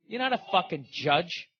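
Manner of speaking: normal talking voice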